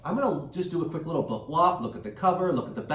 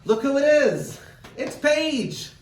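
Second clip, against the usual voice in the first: excited reveal; 11 semitones higher, 7 dB louder, slower than usual. Acted. Reveals puppet guest excitedly.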